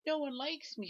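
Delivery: high, sorrowful little turtle voice; blue turtle saying nobody likes him; sad little turtle. Acted. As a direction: whimper it like a lonely baby turtle. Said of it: "says the blue turtle".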